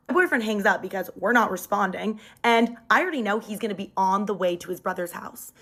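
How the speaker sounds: ordinary delivery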